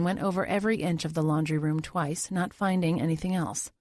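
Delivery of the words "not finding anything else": usual speaking voice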